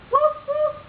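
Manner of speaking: put-on high, loud dog bark for a toddler; cheerful doggy woof; playful woof. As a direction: bark like a happy puppy. Parent barks like a dog.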